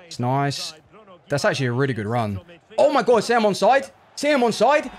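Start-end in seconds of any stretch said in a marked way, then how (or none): 2.8-4.9 high, loud desperate begging; frantic hope at the replay; excited pleading. Real, not acted. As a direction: plead with the screen, voice jumping up. Gamer begging for goal onside.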